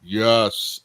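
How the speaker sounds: mock macho deep voice; drawn-out, manly deep "yes"; deep testosterone voice. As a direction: put on a big testosterone-heavy voice. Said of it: "it has a very deep testosterone voice".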